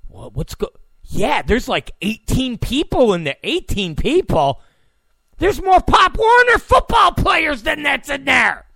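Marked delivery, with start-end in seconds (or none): 5.4-8.7 loud, high, disbelieving shout; incredulous shout. Real, not acted. Comic ranting to his audience, loud incredulous shout. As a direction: yell it out in disbelief. Incredulous rant about tiny party.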